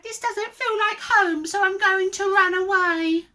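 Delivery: high, forlorn character voice; hammed-up high-pitched woe; wailing cry. A narrator's reading. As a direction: lament it like a hurt little dinosaur. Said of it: "And he cried".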